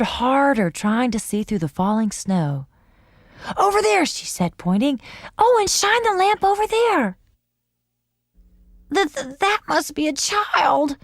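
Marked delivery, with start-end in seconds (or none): 3.6-4.1 high, urgent; excited. A narrator's reading. Pointing excitedly. 5.4-7.2 high, eager command; urgent. A narrator's reading. Urgent instruction. 8.9-11.0 weak, shivering cat voice. A narrator's reading. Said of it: "shivered ... in a very weak voice".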